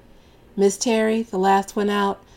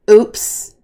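loud careless oops; 毫无歉意地说哎呀; unapologetic oops; 5 semitones higher, 11 dB louder than usual. Acted. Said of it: "I say unapologetically".

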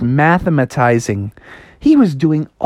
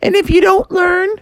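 high-pitched mom voice; high mock-motherly lecture; Froggy's nagging mom. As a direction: pile up dire warnings, pitch climbing higher and higher.

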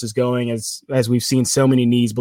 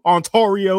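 Ontario in a playful Southern accent, higher, middle vowel as "or"; Southern kid accent; 7 semitones higher, 3 dB louder than usual. Shown as a demonstration. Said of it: "I'm gonna say it like a Southern kid".

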